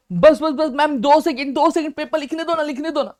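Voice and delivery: high, insistent student begging the teacher; pleading exam student. Acted. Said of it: "बोलते हैं ना हम लोग एग्जाम के टाइम पे".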